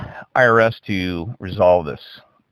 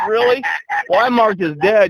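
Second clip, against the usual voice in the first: incredulous; 11 semitones higher than usual. Retold or imitated. Caller told he's dead, retorts in disbelief. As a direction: replay the shock, pitched way up. Retelling his disbelief to IRS.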